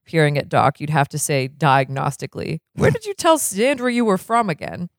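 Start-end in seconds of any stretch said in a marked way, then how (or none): 2.8-4.9 suspicious doctor voice. Acted. Blatnoyd questions Doc.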